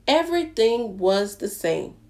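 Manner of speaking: plain reading voice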